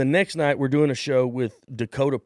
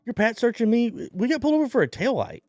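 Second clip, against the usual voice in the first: high, incredulous protest, quick on the second line; mock disbelief; 12 semitones higher than usual. To the cop during the roadside search, high incredulous disbelief. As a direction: squeak out your outrage at the search.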